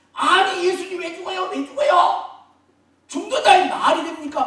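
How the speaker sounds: very high, loud protest; acted scolding. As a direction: browbeat him in outraged disbelief. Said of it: "막 윽박지르고 막 꾸짖고".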